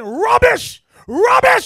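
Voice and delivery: mother yelling scorn at her daughter about God's word; furious shout. Retold or imitated. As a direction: scream it down in disgust. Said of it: "her mother was saying".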